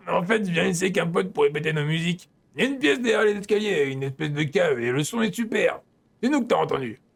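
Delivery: voix de personnage légèrement composée; garçon du jeu qui se confie. Acted. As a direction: livre ton secret de musicien, doublage léger. (voix jouée de personnage de jeu)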